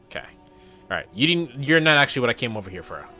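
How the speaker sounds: ordinary talk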